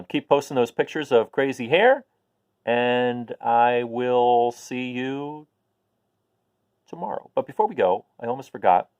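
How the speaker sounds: usual speaking voice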